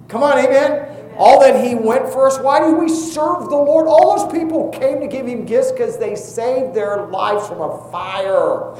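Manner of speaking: high, loud, rising preacher's call to the congregation; preaching at full force. Acted. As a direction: drive it home like a revival preacher.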